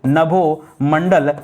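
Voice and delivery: नभोमंडल drawn out slowly, every syllable clear; slow spelled-out word. Shown as a demonstration. Teacher stretches नभोमंडल slowly so students catch the spelling. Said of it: "क्या होगा दोस्तों?".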